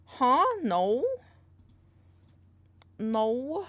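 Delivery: ordinary talk, nothing marked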